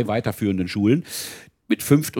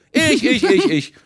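put-on eager kids; 7 dB louder than usual. Children shouting to volunteer.

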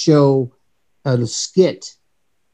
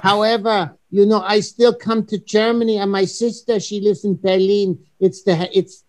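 higher, mock European accent as Heinz; Heinz's accent; 4 semitones higher than usual. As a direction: put on Heinz's accent, politely chatty. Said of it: "the whole entire show in Heinz's accent".

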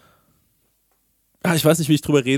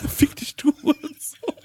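high laughing repeat; 13 semitones higher, slower than usual. Real, not acted. Repeating the friend's whispered joke, laughing. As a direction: laugh through the words.

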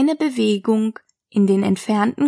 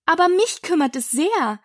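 higher, prompt objection; quick; 6 semitones higher than usual. A narrator's reading. Alice objects to the pigeon, quickly. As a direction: jump in with a quick protest. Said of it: "sagte Alice schnell".

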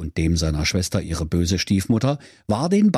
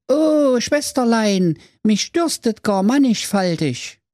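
put-on much higher pompous boy voice; theatrical little brother; 12 semitones higher than usual. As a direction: sigh like a fairy tale prince. Brudi figure.

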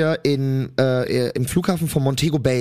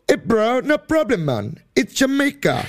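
much higher, easygoing Jamaican lilt; laid-back Jamaican "no problem, man"; Jamaican hotel porter; 9 semitones higher than usual. Retold or imitated. Jamaican porter reassures the vomiting tourist, higher and easygoing. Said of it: "meinte der wirklich original zu mir".

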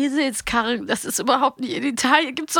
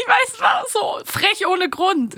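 amused excitement; 9 semitones higher, slower than usual. Real, not acted. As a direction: savour how cheeky it is, nearly shouting. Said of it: "das ist so witzig". (begeistert und lachend, langsam und laut)

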